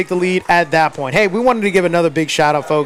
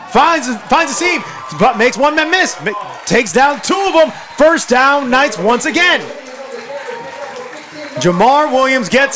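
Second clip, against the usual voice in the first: raised, higher-pitched big-play call; shouted football commentary; excited play-by-play; 5 semitones higher than usual. Real, not acted. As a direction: call the breakaway run with mounting excitement.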